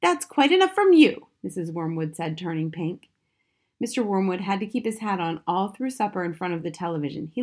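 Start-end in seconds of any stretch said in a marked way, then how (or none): 0.0-1.3 high, loud mother's rebuke; indignant scolding. A narrator's reading. Mother to tell-tale daughter, indignant. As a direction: shut the child up, voice raised high.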